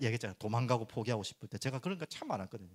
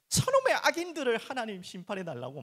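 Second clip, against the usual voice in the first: louder, higher cry for judgment; yelling lament; 9 semitones higher, 6 dB louder than usual. Retold or imitated. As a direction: bellow a psalm of complaint.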